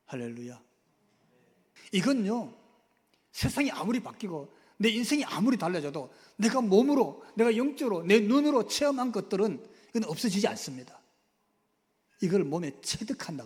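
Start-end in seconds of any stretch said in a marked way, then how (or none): 1.9-11.0 rising, louder preacher's proclamation; preaching at full force. Acted. Preacher declaring to the congregation with rising force. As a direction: hammer the point home to the congregation.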